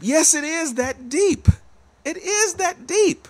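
high, slow, emphatic insistence. Real, not acted. Preacher insists to Al it matters, slow and high. Answering a doubter named Al.

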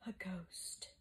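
spooky, airy whisper; breathed whisper. A narrator's reading. Antonius suspects a ghost, breathing the words. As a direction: breathe it out without voice. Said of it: "Antonius breathed".

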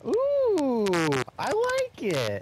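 high, slow, drawn-out delight; delighted ooh. Real, not acted. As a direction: gush over it, stretched out. Delighted at name meaning.

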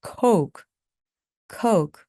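coke with a clear ou glide. Shown as a demonstration. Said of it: "어우 발음을 반드시 내주시기 바랍니다".

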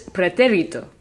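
pretérito with the stress on té; pretérito said as pre-TÉ-ri-to; third-to-last stress. Shown as a demonstration. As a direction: hit the TÉ of pretérito. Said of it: "emphasis is on the third to last".

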